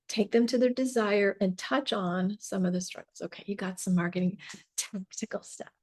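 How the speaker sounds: normal talking voice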